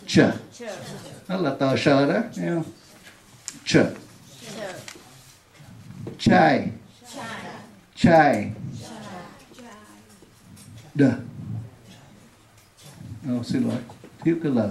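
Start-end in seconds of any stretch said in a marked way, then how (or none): 6.2-8.5 Chai said with a crisp English ch; model sound drill. Shown as a demonstration. Teacher drilling the ch sound, crisp repetition. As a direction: repeat Chai with a sharp ch. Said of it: "Đọc đi, ch".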